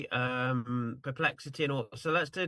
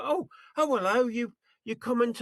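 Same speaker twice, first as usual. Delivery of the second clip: high-pitched startled hello, mock surprise; caught off guard; 9 semitones higher than usual. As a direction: imitate someone flustered picking up a surprise call. Person answering an auto-dialed call.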